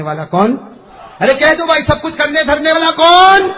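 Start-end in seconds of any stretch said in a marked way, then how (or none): 1.2-1.8 high, loud urging; rousing shout. Real, not acted. Preacher urging the crowd to respond, rousing shout. 1.8-3.5 high, very loud call to respond; rousing shout. Real, not acted. Preacher demanding the congregation's answer, rousing shout.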